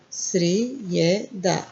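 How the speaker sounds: Srijeda drawn out very slowly. Shown as a demonstration. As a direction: sound out Srijeda slowly for learners.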